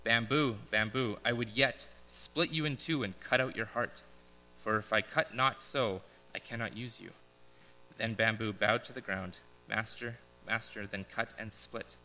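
solemn parable reading with pauses; story narration. Acted. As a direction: read it like a gentle fable to the congregation. Reads a parable aloud.

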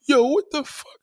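real shocked yelp. Real, not acted. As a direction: jump in pitch in disbelief. Reacting to a crude chat message.

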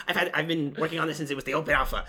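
mock the self-important gamer; mocking nerd voice. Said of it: "I like how you had a mocking voice".

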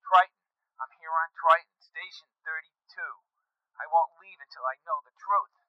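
high, strained voice over a weak line; anguished caller reporting in; distraught man on the radio. Acted.

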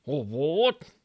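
lightly voiced shocked bloke; Bunce aghast. Bunce protests.

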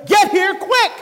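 panicked call. Pretend.

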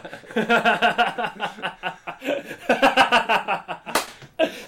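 long, high, loud cackling laugh; helpless burst of real laughter; big laugh. Real, not acted. Cracking up with a friend about movie tastes, laughing hard.

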